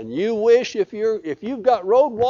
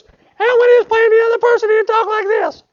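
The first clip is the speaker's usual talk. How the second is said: much higher, louder put-on voice; other mask voice; 7 dB louder than usual. Shown as a demonstration. Preacher showing the actor's second face in a squeaky voice.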